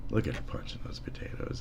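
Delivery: hushed whisper to the cat. Pretend. Streamer whispering to the cat about the potatoes. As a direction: whisper it like a secret.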